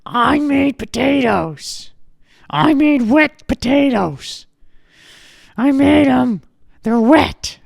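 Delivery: stubborn granny defending her potatoes; 고집스러운 할머니, 높고 크게; high, loud granny insisting, slow. Retold or imitated.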